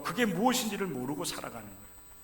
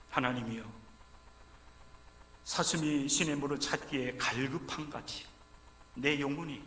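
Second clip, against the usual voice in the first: quiet psalm recitation; 6 dB quieter than usual. Retold or imitated. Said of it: "그는 이렇게 표현을 하고 있어요".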